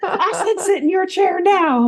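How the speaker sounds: shrill shouting general; high yelling general. Retold or imitated. Puppet general screaming at kids.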